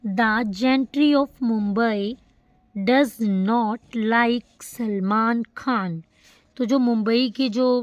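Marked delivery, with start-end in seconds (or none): 0.1-6.0 words drawn out one by one; very slow example reading; slow dictation. Shown as a demonstration. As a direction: read it slowly for the class. Teacher reads example sentence aloud.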